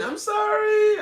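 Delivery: very high, loud drawn-out call; wailed apology. Real, not acted. Host calling to departed viewer, wailing apology. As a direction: wail it after someone leaving. Calling after guest who left.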